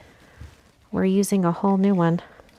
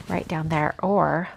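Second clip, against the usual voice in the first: ordinary delivery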